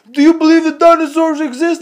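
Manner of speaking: high, loud, funny comedian voice; exaggerated high-pitched celebrity impression; Tracy Morgan. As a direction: squawk it out as a comic impersonation. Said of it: "he talks really funny".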